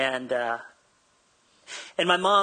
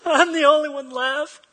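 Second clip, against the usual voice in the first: much higher, louder, slow self-pitying wail; mock sob story; 11 semitones higher, 7 dB louder, slower than usual. Pastor mocking Elijah's complaint to God, hammed-up whining. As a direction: ham up the poor-me moan. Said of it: "really sad sob story".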